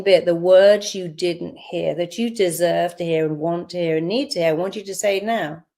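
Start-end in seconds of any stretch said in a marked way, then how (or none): none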